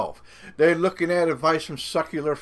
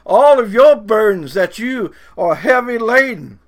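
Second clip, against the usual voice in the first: louder, higher preacher's quote; Jesus; 5 semitones higher, 6 dB louder than usual. Retold or imitated. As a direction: proclaim it emphatically as a divine invitation. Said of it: "He just said".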